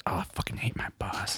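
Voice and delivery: hushed whisper. Pretend. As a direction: whisper it behind the boss's back. Mock secret complaint about boss.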